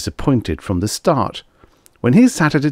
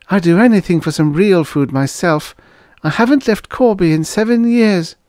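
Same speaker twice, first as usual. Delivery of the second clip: lightly voiced young woman, higher; a helpful young waitress, raised voice; 12 semitones higher, 4 dB louder than usual. Girl character voice.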